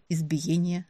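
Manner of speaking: ordinary delivery, nothing marked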